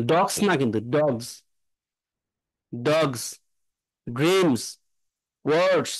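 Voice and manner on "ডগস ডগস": ডগস ending in a voiced z; dogs with z. Shown as a demonstration. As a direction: finish ডগস with a z buzz. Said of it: "জ উচ্চারণ হবে".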